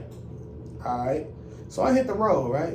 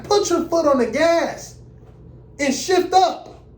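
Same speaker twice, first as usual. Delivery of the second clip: much higher, louder pushy command, slowing on shift up; imitated examiner order; 9 semitones higher, 4 dB louder than usual. Examiner pushing the driver over train tracks, high and loud. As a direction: bark it at the driver like an impatient examiner.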